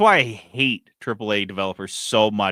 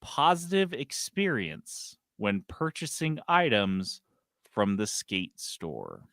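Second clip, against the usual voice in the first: slow reading; 5 dB quieter, slower than usual. Shown as a demonstration. Podcaster quoting the game developer's statement, slow reading. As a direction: read it out slowly, phrase by phrase. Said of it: "I'm gonna read this slow".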